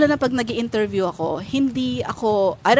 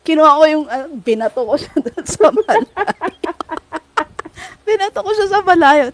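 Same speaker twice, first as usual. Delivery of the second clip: usual speaking voice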